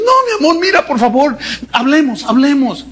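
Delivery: high, begging; mock pleading boyfriend; pleading husband. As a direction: beg her to stay.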